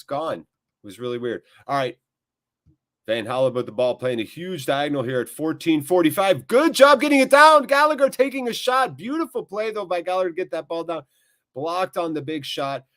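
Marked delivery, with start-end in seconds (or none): 3.1-12.8 excited play-by-play. Real, not acted. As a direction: rise to a high, loud call on the shot. Live match play-by-play.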